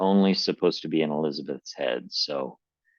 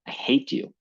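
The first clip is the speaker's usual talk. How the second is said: a hammed-up high scream without much force; mock scream; 9 semitones higher than usual. Voicing Mom hating Dad, a mild mock scream. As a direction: pitch it up like a scream, keep it light. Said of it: "Mom screamed".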